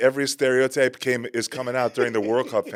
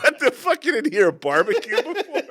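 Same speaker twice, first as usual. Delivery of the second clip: high, loud incredulous outburst; mock disbelief; 4 dB louder than usual. Reacting to tourists amazed by barbecue, high mock disbelief. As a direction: exclaim in laughing disbelief.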